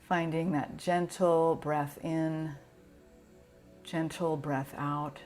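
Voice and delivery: usual speaking voice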